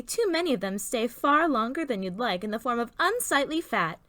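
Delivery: ordinary delivery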